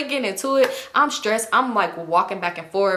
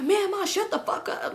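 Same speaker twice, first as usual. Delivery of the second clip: exasperated son; 9 semitones higher, 4 dB quieter than usual. Retold or imitated. Son snapping at his mother.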